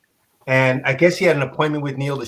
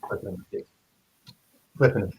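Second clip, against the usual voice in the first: hushed, slow demo of the flipping; softly acting out the slow page turns; 7 semitones lower, 8 dB quieter, faster than usual. Shown as a demonstration. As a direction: drop to a near whisper as you show it. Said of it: "flipping the pages slowly like this".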